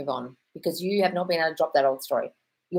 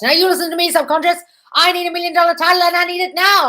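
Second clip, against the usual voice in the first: loud, high shouting at your own mind; yelled command; 11 semitones higher, 10 dB louder than usual. Retold or imitated. Napoleon Hill yelling at his subconscious, a loud high shout. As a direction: bellow it like a demand in the night. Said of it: "he literally yelled at his subconscious".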